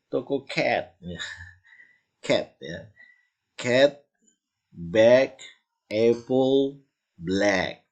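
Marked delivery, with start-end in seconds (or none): 0.1-1.0 cat said with an Indonesian e vowel in toko cat; teacher mocks saying cat the local way; wrong English cat. Shown as a demonstration. Teacher shows the wrong way to read cat. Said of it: "jangan bilang". 3.5-7.8 cat, bag, apple said with a wide open ae vowel; English ae vowel. Shown as a demonstration. Teacher drills cat and bag with open ae. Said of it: "Jadi itu tidak bisa dibaca".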